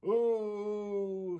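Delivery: long high wailing oh; baby-like wail. Acted. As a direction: wail like a baby who dropped its bottle. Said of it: "she cried, like a baby that's dropped its bottle".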